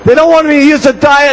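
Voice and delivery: hammed-up self-pitying shout; wailing wife. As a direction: whine out her woes to a friend on the phone. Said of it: "She says".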